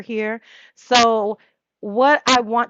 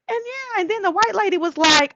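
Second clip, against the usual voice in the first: much higher put-on voice; parodied storyteller; 10 semitones higher than usual. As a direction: parody a gossiping woman. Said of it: "I can't just say".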